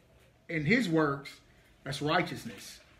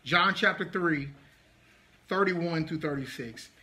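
ordinary delivery

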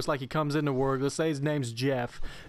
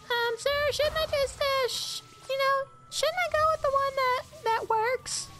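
much higher, timid squeaky voice. Retold or imitated. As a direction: squeak it out nervously. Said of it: "his normal voice is something completely different".

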